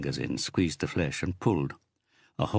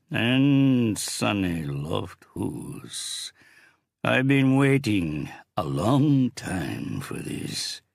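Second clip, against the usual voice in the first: big booming voice, slow; thundering; 6 semitones higher, 4 dB louder, much slower than usual. Acted. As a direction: boom it out with relish. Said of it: "the big man thundered".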